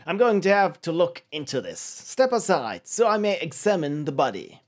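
self-important mock-British sleuth; poorly British detective. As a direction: take charge in a fake British accent. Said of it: "they all sound vaguely and poorly British".

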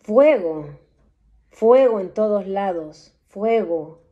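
loud visionary alarm, arde stretched slow; alarmed proclaiming. Acted. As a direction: announce the blaze as if watching it. Vision of fire everywhere.

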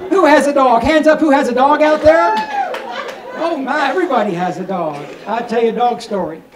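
ordinary delivery

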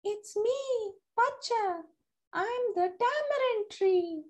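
high, soft tree voice; gentle whispery tree spirit; talking tree. A narrator's reading.

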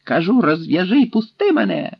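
mildly shouted demand. A narrator's reading. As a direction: demand release with a restrained shout. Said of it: "крикнув татарин".

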